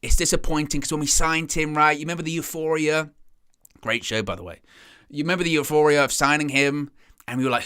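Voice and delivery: ordinary talk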